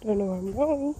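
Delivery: ordinary talk, nothing marked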